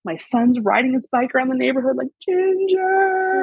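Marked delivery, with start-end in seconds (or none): 2.3-3.4 a long, high call across the street. Retold or imitated. Son on his bike calling for the cat, high call. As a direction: call the lost cat's name down the block.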